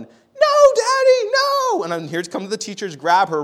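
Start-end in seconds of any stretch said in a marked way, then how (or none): 0.0-1.8 put-on high, loud crying child's wail; child yelling. Pastor imitating his daughter's loud wail. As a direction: wail it like a little girl being dragged off. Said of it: "she's yelling".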